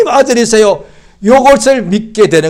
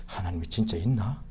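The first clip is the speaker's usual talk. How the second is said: inner question; 10 semitones lower, 17 dB quieter than usual. Retold or imitated. Inner doubt dawning.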